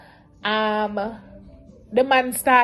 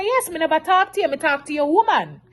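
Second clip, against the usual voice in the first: heated retort; 6 semitones higher than usual. Retold or imitated. Retold argument with venue owner.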